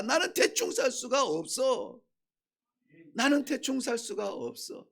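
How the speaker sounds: normal talking voice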